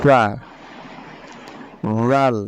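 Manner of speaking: ফা, রাল, র said with the tongue tip on the upper front teeth, light and thin; tongue tip on upper teeth, light articulation; thin, light Arabic ফা রাল র; Arabic letter demo. Shown as a demonstration. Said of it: "আমি আবার বলছি".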